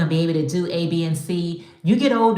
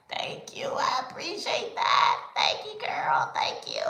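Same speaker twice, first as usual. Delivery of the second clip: breathy whispered gratitude, near tears; whispered thanks; 7 semitones higher, 4 dB quieter than usual. Retold or imitated. Maker reading a kind review, touched whisper. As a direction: whisper it as if overcome. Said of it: "You be like".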